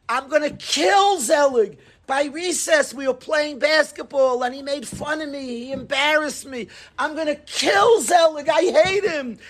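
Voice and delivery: high-pitched angry rant building to a shout; acted tantrum, pitched well up; smoking-mad kid. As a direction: rage about your friend like a furious kid. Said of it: "He's smoking mad".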